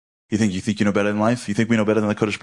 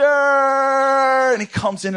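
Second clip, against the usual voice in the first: high, slow, loud mock-epic shout; excited shout; 4 dB louder, much slower than usual. Speaker acts out his dream scene, loud mock shout. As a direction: announce it like a movie trailer.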